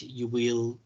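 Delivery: ordinary delivery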